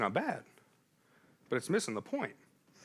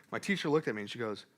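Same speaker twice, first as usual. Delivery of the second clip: normal talking voice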